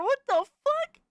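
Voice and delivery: high, startled what the fuck; gasping in disbelief; shocked outburst. Real, not acted. Streamer reacting to a shocking clip, startled.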